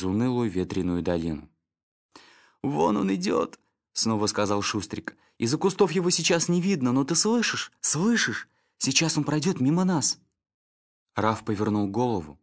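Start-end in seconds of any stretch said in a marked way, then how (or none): 2.6-3.6 much higher, thrilled little dog; eager yelp of a small dog; excited dog Snitter. Acted. Snitter, excited, far timbre. 5.4-10.2 much higher, thrilled little dog; urgent eager terrier whisper-talk; excited dog Snitter. Acted.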